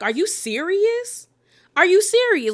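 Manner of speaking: shocked disbelief. Retold or imitated. Danielle hit with the hair lady claim, high disbelief. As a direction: mimic Danielle stunned by the accusation. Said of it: "Danielle was like".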